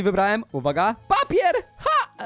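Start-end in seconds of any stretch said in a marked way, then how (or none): 1.1-2.2 high, squealed exclamation; excited reveal. Acted. Revealing the stalker's pick, high excited yelp.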